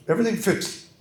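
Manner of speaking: put-on high, swallowed English accent; Berlin imitation. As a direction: mimic a mumbling Oxford don. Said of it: "I'll try to".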